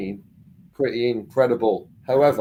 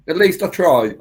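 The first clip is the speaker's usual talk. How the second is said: defensive; 6 semitones higher, 8 dB louder than usual. Real, not acted. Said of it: "especially with that accent".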